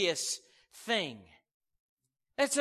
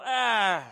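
dismissive ah; 7 dB louder than usual. Retold or imitated. Listener rejects, acted.

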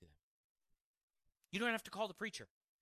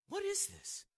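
lightly voiced puzzled coworker, up high; a hint of a baffled man, voice lifted; 13 semitones higher than usual. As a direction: echo his bewildered question in a raised voice.

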